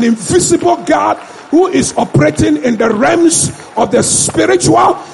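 loud, high preaching shout; preaching at full force. Real, not acted. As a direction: rouse the congregation. Preaching climax to congregation.

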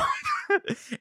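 high laugh. Real, not acted. Laughing at the story.

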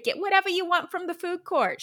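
high, squeaky put-on mom voice; a silly high-pitched mom impression; mock mom voice. To her daughter at the mall, a high mock mom voice. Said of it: "She didn't sound like that".

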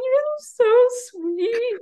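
high, slow, tearful whimper; crying. Retold or imitated. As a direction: squeak it out through sobs. Retelling crying while dogs kiss her.

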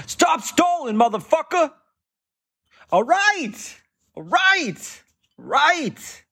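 high mock gunman's voice, pushing; impatient gunman. Gunman to stalling speller, high and impatient. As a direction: hurry him up in a squeaky put-on voice. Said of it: "someone put a gun to her head".

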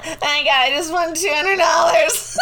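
high, loud, laughing amazement; laughing disbelief. Retold or imitated. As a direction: gasp and laugh at the jackpot. Retold jackpot win disbelief.

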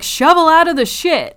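high, loud imitated slogan; mock-ranting catchphrase, high and loud; imitated crazy rant. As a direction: belt it out like a ranting crank. Acting out the crazy man's rant.

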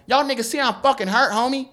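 high, loud whiny mock voice of an injured man; mock Franklin. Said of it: "Be like".

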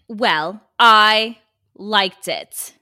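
loud, slow New Jersey mom; New Jersey accent. Pretend. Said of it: "I got a mother, New York, New Jersey area".

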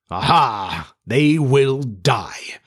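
villainous cry, slowly drawn out; gleeful triumph. A narrator's reading. Hook gloats over his plot, slow and triumphant. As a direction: relish each word in triumph. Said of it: "He burst into laughter".

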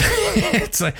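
high, slow laugh mid-phrase; chuckling through it. Real, not acted. Laughs recalling son's joke.

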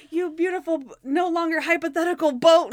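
high, loud mock adoration; gushing delight. Captain adores the boat he can finally buy, gushing. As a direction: gush as an imitated lottery winner.

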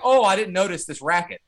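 exaggerated feigned surprise; mock obliviousness. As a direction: play a comically oblivious scientist. Mocks oblivious scientists.

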